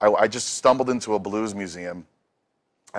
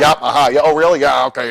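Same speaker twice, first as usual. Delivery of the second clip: mock half-listening phone talk, higher and louder; distracted phone chatter; 6 semitones higher, 9 dB louder than usual. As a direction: toss off quick phone replies while busy. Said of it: "while he's talking to me, he's, like, going".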